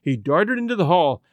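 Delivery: plain reading voice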